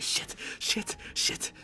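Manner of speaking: under-breath whispered cursing; a scared whisper while sending the email; whispered panic. Retold or imitated. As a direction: hiss it nervously under your breath. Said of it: "I was like".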